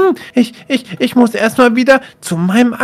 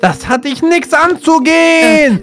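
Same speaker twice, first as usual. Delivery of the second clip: raised, high-pitched scolding; angry rebuff; 5 semitones higher than usual. Acted. Brother caught with magic tells Lumi off angrily. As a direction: shut the snooper down at a high pitch.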